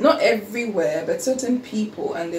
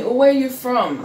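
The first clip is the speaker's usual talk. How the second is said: raised, louder questioning as people ask; nosy questioner; 5 semitones higher, 5 dB louder than usual. Retold or imitated. Quoting people who still ask about origins, nosy questioner. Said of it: "people would still ask me".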